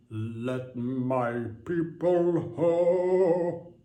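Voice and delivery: slow, high, booming biblical proclamation; mock sermon decree; preacher parody. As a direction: proclaim it like Moses.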